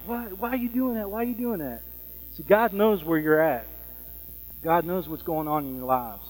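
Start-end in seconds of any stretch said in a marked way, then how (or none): 0.1-1.8 high but softer frantic retelling; understated high-pitched panic; mock freaking out. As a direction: imitate someone freaking out, held back in volume. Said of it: "she was freaking out".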